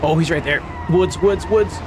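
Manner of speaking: urgent shouted warnings; being chased. Real, not acted. Chaser right behind, crashing into woods.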